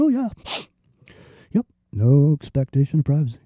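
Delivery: lightly mocking, sheepish shrug of a yes; mock meek compliance. Mocking citizens who rolled over after nine eleven, sheepish. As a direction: hand it over like a docile sheep. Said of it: "everyone just fucking rolled over and just went".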